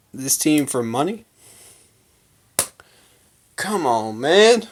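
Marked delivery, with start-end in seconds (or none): 3.6-4.6 exasperated appeal. Real, not acted. Fan chiding a jacket burner, exasperated.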